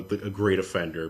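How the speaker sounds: usual speaking voice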